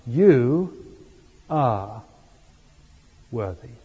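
"are" set apart by pauses, low and deliberate, "worthy" trailing softly; stress on ARE. Shown as a demonstration. Preacher showing the congregation a third emphasis, stress on "are". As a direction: isolate "are" between pauses, then let "worthy" drop. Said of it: "put the emphasis on are".

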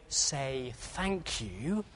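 very soft, drawn-out parental prompt; hushed, stretched-out coaxing; mouthed prompt. Retold or imitated. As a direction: imitate mouthing a reminder to a child, slowly and quietly. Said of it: "when we were busy mouthing".

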